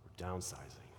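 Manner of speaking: hushed, pitying downsizing; mock grief. Shown as a demonstration. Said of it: "Did you see my eyebrows?".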